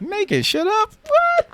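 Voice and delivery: high, rising outraged "what?"; indignant disbelief. Real, not acted. He protests his fiancée's claim in high disbelief. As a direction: jump way up in mock outrage.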